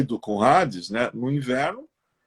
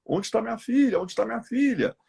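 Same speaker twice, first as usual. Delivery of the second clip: mildly acted desperation, pitch lifted; desperate mother; 7 semitones higher, faster than usual. Storyteller voices Demeter searching for her abducted daughter, mildly desperate. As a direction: retell a mother's frantic plea in a higher voice. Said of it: "a mãe fica desesperada".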